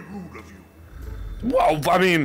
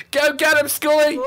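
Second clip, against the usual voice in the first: high, loud playful war cry; excited shout to a companion; calling out; 11 semitones higher, 5 dB louder than usual. Pretend. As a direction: cheer your sidekick into attack. Sends skull off, calling out.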